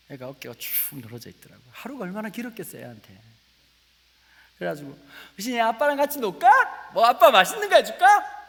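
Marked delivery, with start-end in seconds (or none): 5.4-8.5 high, loud, sing-song eager dad; overdone cheerful offer. Acted. Dad coaxing his tired daughter to play, overdone cheer. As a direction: oversell the fun, bubbling over. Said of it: "엄청 오바를 했죠".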